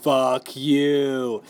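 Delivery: low, stretched mock curse; drawn-out, deep-voiced host put-down; Chris Harrison. Host cursing the suitor for ruining the show, low and stretched. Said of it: "Chris Harrison's like".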